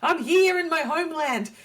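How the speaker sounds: high, thrilled gush; mock-thrilled tourist gushing; excited. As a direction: squeal with delight at arriving home. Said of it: "I was so excited 'cause I was like".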